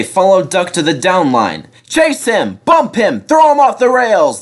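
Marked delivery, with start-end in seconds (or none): 1.9-4.4 high, rising mob yell; yelling trucks. A narrator's reading. The trucks yell as they chase Duck, high and loud. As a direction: egg each other on. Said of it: "they yelled".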